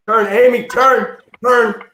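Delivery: high, loud shouted plea to turn; urgent warning shout. Retold or imitated. Kid yells to friend on runaway sled, urgent warning shout. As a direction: yell it out, desperate to steer a friend.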